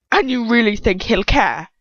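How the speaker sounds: a hint of scornful laughter in it; mocking villain. A narrator's reading. Dolohov taunting her about Lucius, lightly mocking. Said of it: "He laughs out loud at that".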